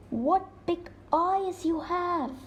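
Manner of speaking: put-on a child edging closer, curious; little girl. Girl questions disguised wolf.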